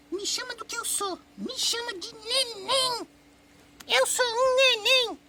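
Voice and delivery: put-on tiny, very high baby voice; puppy as baby. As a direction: drawl it slowly in a squeaky baby voice. Dá voz ao cachorro como neném. (vozinha bem aguda de neném)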